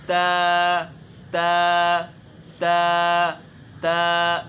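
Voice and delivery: تا loud, clean ending; correct ta. Shown as a demonstration. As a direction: repeat تا cleanly. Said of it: "آخر میں تا". (تا صاف اختتام)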